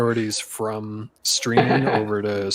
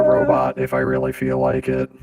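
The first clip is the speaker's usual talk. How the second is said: deep robotic voice filter; 10 semitones lower than usual. Real, not acted. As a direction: sound like a robot through a voice mod. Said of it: "I can just sound like a robot".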